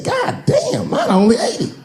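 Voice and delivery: very high shocked yelp. Retold or imitated. A comic outraged at ticket prices, shocked squeal. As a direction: squeal it out in disbelief.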